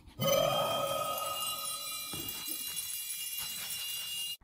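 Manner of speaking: high, breathless ticklish giggle; a squeaky ticklish laugh. Acted. Tummy scratched giggle.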